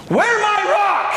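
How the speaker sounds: high, slow grunting caveman. Pretend. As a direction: play a dim cave dweller. Caveman bit.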